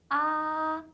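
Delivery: 아 held high and level; first tone. Shown as a demonstration. Teacher showing first tone, 아 high and level. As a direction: stretch 아 straight along one high note. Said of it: "높은 음에서 쭉 이어지는".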